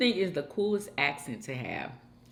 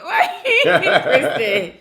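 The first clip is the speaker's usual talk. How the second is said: laughing; 10 semitones higher, 7 dB louder, much slower than usual. Real, not acted.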